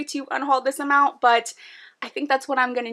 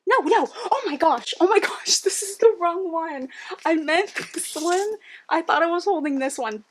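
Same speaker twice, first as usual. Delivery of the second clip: flustered surprise; 5 semitones higher than usual. Real, not acted. Realizes wrong book held.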